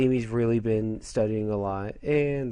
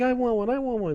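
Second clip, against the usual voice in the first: put-on much higher, childish whine; whiny high pleading; 12 semitones higher than usual. Usagi begging for a pin, high childish whine. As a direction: beg like a greedy kid. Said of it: "Then Usagi's like".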